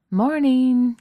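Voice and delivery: high, loud bright greeting; a sunny, loud hello from the kitchen; cheerful. Acted. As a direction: sing out the good morning. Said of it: "Kate says cheerfully".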